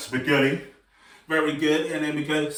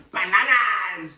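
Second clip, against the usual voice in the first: crazy banana voice; 3 semitones higher, slower than usual. Shown as a demonstration. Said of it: "'Cause we're going crazy".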